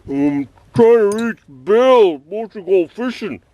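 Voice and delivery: high, slow, put-on caller voice; mock a caller with slow, swooping high words; imitated wrong-number caller.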